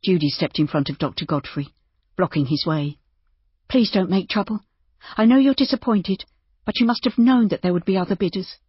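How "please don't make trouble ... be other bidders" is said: higher, louder woman's character voice; pleading woman innkeeper. A narrator's reading. Innkeeper blocking the doctor's way, pleading. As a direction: plead with him to keep the peace.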